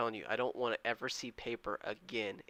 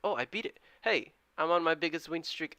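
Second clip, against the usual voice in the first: higher, delighted cheer; excited; 6 semitones higher, 4 dB louder than usual. Real, not acted. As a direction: celebrate the record win streak. Celebrates biggest win streak.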